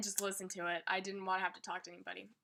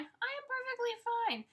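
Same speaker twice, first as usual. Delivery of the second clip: fake fine; 13 semitones higher than usual. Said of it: "you're just like".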